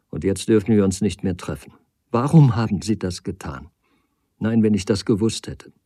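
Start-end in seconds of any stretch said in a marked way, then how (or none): none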